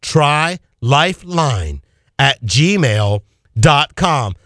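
very slow, each word of "Try Lifeline at gmail dot com" spelled out; slowly dictating the email address. Shown as a demonstration. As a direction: draw out every word so they can write it down. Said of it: "I'm gonna say it again slow".

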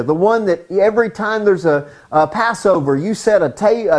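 normal talking voice

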